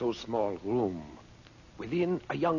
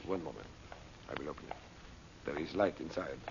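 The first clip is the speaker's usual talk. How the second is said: lower, much softer; hushed; 9 semitones lower, 9 dB quieter, faster than usual. Acted. Guide opening a secret door, hushed. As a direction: murmur at the hidden door.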